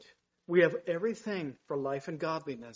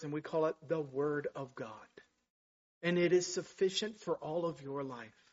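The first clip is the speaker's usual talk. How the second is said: ordinary talk, nothing marked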